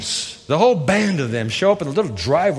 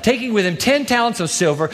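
normal talking voice

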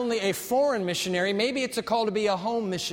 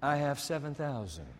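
lower, softer, hushed divine voice; quiet voice of God; 4 semitones lower, 7 dB quieter than usual. Acted. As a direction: say it gently, as God confiding. God's voice, quiet and low.

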